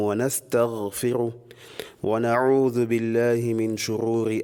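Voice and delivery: low, even, measured recitation; solemn sermon opening, evenly intoned; formal Arabic invocation. Acted. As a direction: recite the opening formula in a steady monotone.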